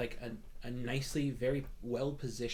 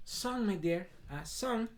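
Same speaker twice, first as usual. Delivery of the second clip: higher voice calling back through the curtain; calling to Song; 5 semitones higher than usual. Acted. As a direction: call sweetly to the back room. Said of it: "pull it and go".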